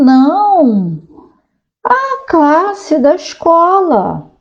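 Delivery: put-on high, drawn-out kid's correction; child classmate's correction. Classmate correcting a panicked Mafalda, high kid voice. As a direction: stretch it out high, setting her straight.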